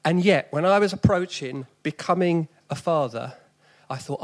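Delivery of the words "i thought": ordinary talk, nothing marked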